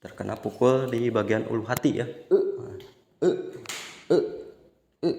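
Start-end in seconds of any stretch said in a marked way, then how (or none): 2.1-5.1 "e" voiced from the base of the throat, mouth not opened wide; vowel e demo. Shown as a demonstration. Teacher showing students the vowel sound, drawn from the throat. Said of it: "jadi ini bunyinya".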